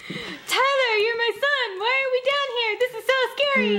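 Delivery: high, quavering worried mom; frightened mother. Acted. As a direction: play a scared soap-opera mother. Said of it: "scene of the week".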